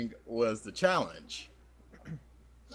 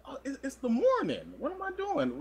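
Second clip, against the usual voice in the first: high bewildered voice; 13 semitones higher than usual. Retold or imitated. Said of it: "I'm like".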